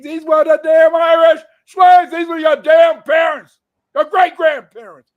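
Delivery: shouted high and loud, hammering it home; angry shout. Real, not acted. Lecturer shouting at listeners about their own ancestors, loud and fierce. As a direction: yell it at the audience in outrage.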